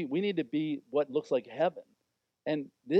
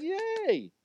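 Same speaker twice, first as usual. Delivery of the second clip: much higher joyful yelp; cheer. Acted. Crowd cheering the healed man, a high bright yelp. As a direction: cheer like a delighted kid.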